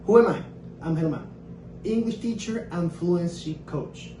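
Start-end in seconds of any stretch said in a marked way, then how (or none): none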